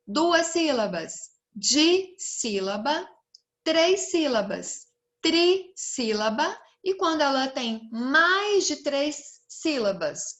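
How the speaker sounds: ordinary delivery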